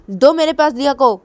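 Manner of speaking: high, loud demand. Acted. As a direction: order her to hand the child over. Grandmother demanding. (ऊँची आवाज़ में माँग)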